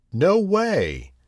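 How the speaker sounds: little pig yelling back his refusal; shouted. Acted. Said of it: "the pig shouted".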